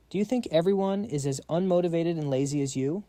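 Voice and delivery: normal talking voice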